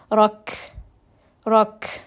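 Rak with an audible breath on the kaf; exhaled kaf. Shown as a demonstration. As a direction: release air after the kaf in Rak. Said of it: "give it just an exhale, a breath".

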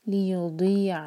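heavy दी from the tongue side; Quran recitation. Shown as a demonstration. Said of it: "heavy पढ़ना है".

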